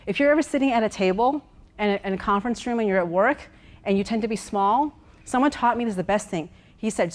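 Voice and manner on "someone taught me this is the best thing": usual speaking voice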